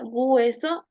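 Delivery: "hueso" said as "güeso", with a G before the diphthong; added G. Shown as a demonstration. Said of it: "prótesis de G ante diptongos".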